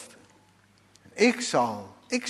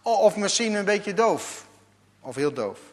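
usual speaking voice

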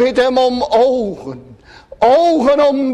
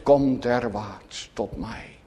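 much softer, sinking into a whisper at the end; hushed invitation; 9 semitones lower, 7 dB quieter than usual. Retold or imitated. Preacher quoting the Lord's call to weary sinners, hushed and softening. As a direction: speak the Lord's call gently, almost breathed. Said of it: "omdat hij je roept".

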